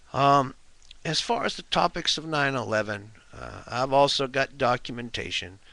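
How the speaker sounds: usual speaking voice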